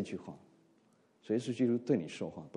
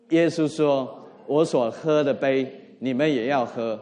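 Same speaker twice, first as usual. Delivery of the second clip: slow, weighty reading of Jesus' words, a bit louder; slow reading aloud; 3 semitones higher, 7 dB louder, slower than usual. Shown as a demonstration. Pastor slowly reads the verse aloud with the congregation, measured and deliberate. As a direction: let each phrase of the verse sink in slowly. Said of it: "慢慢来，我们慢慢来读".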